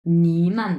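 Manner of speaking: নিমান with a low dipping নি and a light মান; Chinese nǐmen. Shown as a demonstration. Teacher models the full নিমান for learners, dipping then light. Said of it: "একসাথে আমরা কিভাবে উচ্চারণ করব".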